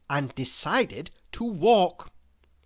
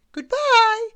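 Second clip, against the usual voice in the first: very high, loud sing-out; high-pitched cheerful character voice; cheery farewell; 8 dB louder than usual. A narrator's reading. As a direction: call goodbye in a very high voice. Little Miss Sunshine calls farewell.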